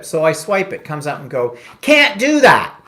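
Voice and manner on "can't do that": loud, high imitation of a scolding machine; berating machine. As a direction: shout the refusal like a bossy card terminal. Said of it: "being berated by a machine".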